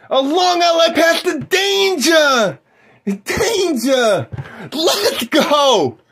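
excited play-by-play caller; sports announcer. Acted. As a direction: call the game like a TV announcer. Plays basketball play-by-play.

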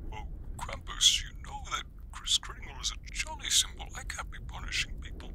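put-on very high, soft whispered old man; a tiny, far-off, fussing whisper; Kris Kringle voice. Kringle on the phone asking Krampus if he is okay, very high soft whisper.

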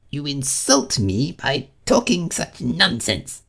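slow, high, affronted character voice; indignant Mouse. A narrator's reading. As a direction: spell out the insult with wounded pride. Offended Mouse walking off.

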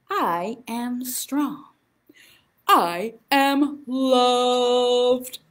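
put-on slow, clear chant for kids; slow affirmation. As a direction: lead the children calmly. Leads children's affirmations.

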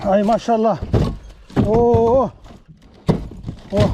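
excited cry. Real, not acted. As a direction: burst out in real delight at the catch. Fish suddenly surfaces, big catch.

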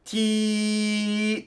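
high, held, buzzing "Tee"; ringing vowel. Shown as a demonstration. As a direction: sustain "Tee" so it rings high. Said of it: "buzzing, ringing sound".

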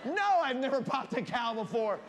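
high, loud, emphatic comic outburst; slow down and punch it with mock indignation; exasperated retort. Boy answering his buddy's silly question, loud exasperation.

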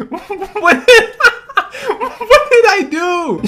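high, disbelieving cry through laughter; laughing protest. Real, not acted. Downed player protests to bullying teammates, high laughing protest. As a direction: protest innocence while cracking up.

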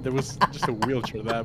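ordinary talk

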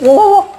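high-pitched, loud "oh!"; short, high startled whoop; startled cry. Real, not acted. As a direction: yelp in sudden surprise. Startled by zombie during fight.

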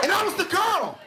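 high, appalled punchline; mock horror. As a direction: deliver the awful discovery in a high voice. Horrified punchline of the realisation.

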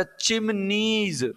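चिमनीज slowly split as चिम-नीज; syllable counting demo. Shown as a demonstration. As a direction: draw out each syllable of चिमनीज. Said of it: "बताओ कितनी है".

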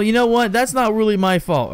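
usual speaking voice

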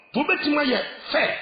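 ordinary delivery

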